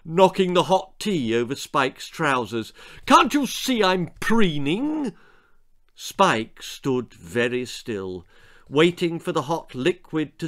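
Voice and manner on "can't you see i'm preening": high-pitched bratty complaint; indignant bawl, pitch well up. A narrator's reading. Preening brat scolds Spike, mildly bawling. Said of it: "bawled the big-haired brat".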